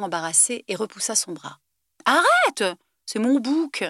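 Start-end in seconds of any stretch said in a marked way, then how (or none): 2.0-3.8 higher, louder cry of protest; embarrassed protest. A narrator's reading. Alex protests as Buffy wipes his goatee, loud and high. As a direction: push her hand away with a yelp.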